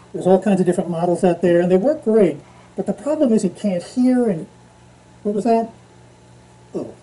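speaking through respirator; covered mouth; muffled through face mask. Real, not acted. Seen 0: Half-face respirator worn, surgical mask over it, throughout speech.

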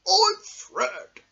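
very high, loud squeaky bear voice; high-pitched cartoon bear; Fred the Bear's voice. Shown as a demonstration. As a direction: squeak it out as a little toy bear. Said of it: "when you hear this sound".

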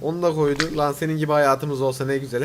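ordinary delivery

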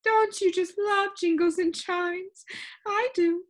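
put-on high, slow complaining old lady voice; a fussy, lilting lady with static in her; complaining sound keeper. Sound Keeper answering the visitor, high and complaining.

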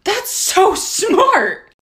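high, very slow mock amazement; drawn-out mock wonder; awed customer. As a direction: act dazzled by a dumb idea. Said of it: "And she goes".

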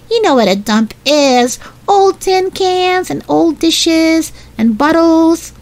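put-on high-pitched little girl, slowing through the list; high, drawn-out explaining to a little brother; young sister explaining. A narrator's reading. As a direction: list it patiently in a high child's voice. Voices Violet explaining to Benny.

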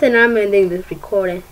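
ordinary delivery

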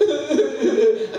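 high mock wail of a hurt child; imitated child crying. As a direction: cry out like a small child.

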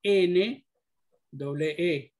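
"N E E" letra por letra, muy despacio; deletreo lento. Shown as a demonstration. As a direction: deletrea pausado para la clase. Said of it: "que es K". (deletreo lento y claro)

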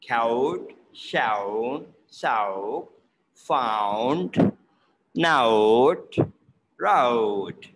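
"note" and "road" with open ah gliding into rounded oh; "road" as a long, dipping "ra-oud"; drawn-out O diphthong. Shown as a demonstration. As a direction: stretch each vowel from ah to oh, as in "shao", "note", "road". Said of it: "to create ow sound".

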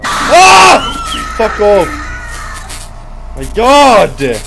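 loud startled scream; shrieking then cursing in fright; scared horror game streamer. Real, not acted.

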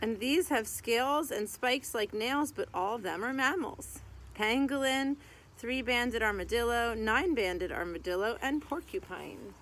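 lively sing-song rhyme reading; reading to children. Acted. Reading a mammal picture book to kids, lively sing-song. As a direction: read the rhyming verse out to little kids.

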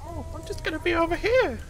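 high mocking baby voice; mock baby talk. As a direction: parrot the game in a high coo. Mimics game line to pod.

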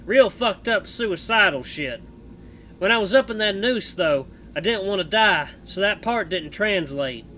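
put-on loud, drawling cowboy accent; Misha cowboy accent. Streamer voicing Misha the bat to Jenna, cowboy accent. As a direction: play the bat as a rough cowboy, American West accent. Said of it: "cowboy accent for Misha".

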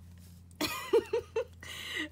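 a high delighted squeal of laughter. Real, not acted. Finding a third seven, high delighted squeal of laughter. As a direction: burst into a giddy squeal.

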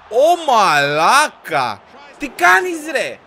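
long, loud, high outcry; drawn-out incredulous shout; exasperated shock. Real, not acted. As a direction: yell slowly in disbelief at the keeper. Another keeper blunder.

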